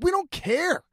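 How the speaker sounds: high, squeaky mock exasperation; a shrill mock whine of not caring. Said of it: "And we're like".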